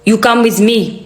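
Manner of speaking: put-on ominous Dracula-like Arabic accent; lightly done Arabic accent, like a film vampire; mock Arabic accent. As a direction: mimic a celebrity's fake Arabic accent, ominous. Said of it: "puts on her best Arabic accent".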